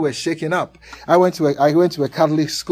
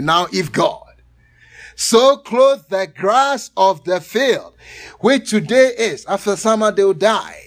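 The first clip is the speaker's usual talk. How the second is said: scripture reading; 3 semitones higher, 5 dB louder than usual. Acted.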